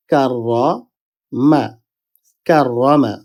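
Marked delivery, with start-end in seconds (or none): 0.1-1.6 slow কাররা, pause, then মা; broken into syllables. Shown as a demonstration. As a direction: draw out কাররামা in separate parts. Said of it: "প্রথম হরফের সাথে এটা মিলিত হবে". (কাররা... মা: ধীরে ভেঙে ভেঙে)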